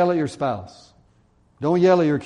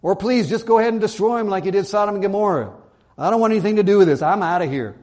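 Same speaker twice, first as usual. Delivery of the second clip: hammed-up refusal, fast, higher and louder; fed-up attitude; 6 semitones higher, 6 dB louder, faster than usual. Preacher voicing a prophet wanting out, fed up. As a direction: rattle it off loudly, wanting no part of it. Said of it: "That was his attitude".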